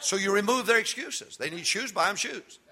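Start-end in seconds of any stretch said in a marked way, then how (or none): 0.0-1.4 high, loud, rhythmic shouted preaching; full-force preaching. Acted. Preacher rousing congregation to work together, full shouted preaching. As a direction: build the congregation up to a roar.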